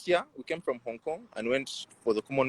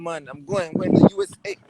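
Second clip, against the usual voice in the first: playful boast leaping high and loud at the end; mock excited bragging; 3 semitones higher, 8 dB louder than usual. Player telling campus friends he is off to America, mock excited bragging. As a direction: brag like a student off abroad, voice shooting up.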